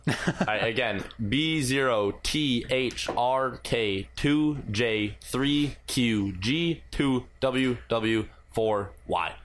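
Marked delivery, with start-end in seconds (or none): 1.3-9.3 slow dictation, each character separate; slow careful spelling. Real, not acted. Host repeating a game code for the chat, slow dictation. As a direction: read it out slowly, one character at a time.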